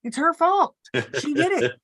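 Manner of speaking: hammed-up blaming husband; mock husband accusing. Husband telling the doctors she did it, mock accusing. Imitating husband deadpan blame.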